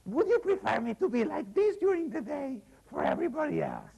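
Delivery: high, strained hag voice; the hag explaining her spell to her husband, high and strained; old hag voice. Acted.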